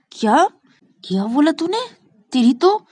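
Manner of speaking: high, loud challenge; indignant retort. Acted. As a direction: fire back at a cheeky sibling. Shruti angry at the threat.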